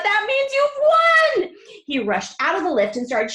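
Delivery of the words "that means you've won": high, loud and slow with delight; excited cry. A narrator's reading. Wonka crying out to Charlie in delight. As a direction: cry it out, overjoyed. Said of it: "he cried out".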